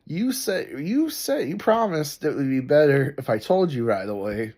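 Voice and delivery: high, pleading sing-song imitation; mocking wife voice. Wife reminding husband of his promise, high mocking plead. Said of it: "And so finally she's like".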